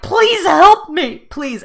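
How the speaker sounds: high, loud, slow begging; desperate plea. Retold or imitated. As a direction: beg for help, nearly sobbing. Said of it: "I'm like".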